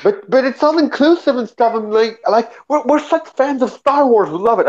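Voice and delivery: high, whiny voice mocking fans. Mocking the fans he met in the theater, high whiny voice. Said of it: "And they were like".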